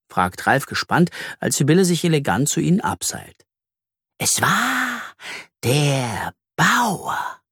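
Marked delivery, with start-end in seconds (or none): 4.2-7.4 slow reveal with suspenseful pauses; mysterious spider voice. A narrator's reading. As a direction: draw out the secret, pitched up. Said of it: "erzählt sie geheimnisvoll".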